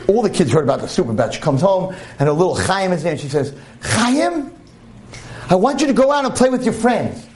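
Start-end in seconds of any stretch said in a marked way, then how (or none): 3.8-7.3 high, eager mother voice; mother calling son. Retold or imitated. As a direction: mum calling her boy out to play.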